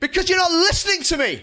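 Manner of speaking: high, loud indignant yell; mock angry outburst. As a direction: shout it like a wronged host.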